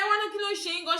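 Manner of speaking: re-enacted exasperation at high pitch; heated challenge. Fed-up passenger challenging the whole bus, heated protest. Retold angry challenge in Yoruba.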